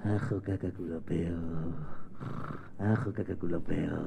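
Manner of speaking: very soft, low sleepy murmur; sleeping baby babble. Acted. Baby brother asleep in bed, faint sleepy babble. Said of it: "el hermanito ya durmiendo".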